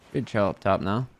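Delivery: ordinary delivery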